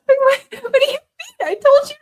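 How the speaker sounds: put-on high, loud, crying child voice; half-laughing mock sobbing son; sobbing kid. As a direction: wail through fake tears like a boy.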